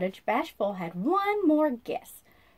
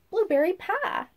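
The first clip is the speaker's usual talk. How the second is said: excited; 7 semitones higher, 5 dB louder than usual. A narrator's reading. Announcing dessert idea.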